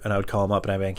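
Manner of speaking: ordinary delivery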